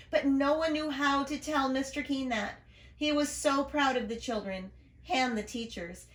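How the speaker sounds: ordinary delivery, nothing marked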